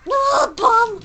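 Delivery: high, loud frustrated cry; frustrated yell. Real, not acted. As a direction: shriek it out in annoyance. Frustrated outburst at game.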